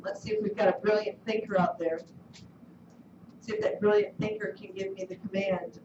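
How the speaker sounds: a low, teasing coax. Real, not acted. Teacher quietly coaxing students to answer. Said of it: "coaxing students to answer".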